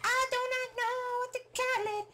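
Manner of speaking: very high, slow squeal; mock despair. Confused fan unsure of the name, high slow squeal. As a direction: squeal it slowly, hammed up.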